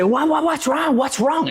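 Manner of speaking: high, stammering mock panic; panicked questioning, acted out high-pitched; freaked out. Agent pulls over to ask screaming client, retold panic. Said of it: "I'm, like, freaking out".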